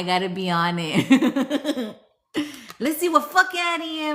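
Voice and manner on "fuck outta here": high-pitched New Jersey brush-off; New Jersey accent. Acted. Reading the card's phrase aloud, in a high New Jersey accent. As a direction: quote it with a raised New Jersey twang. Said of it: "Say it in a New Jersey accent".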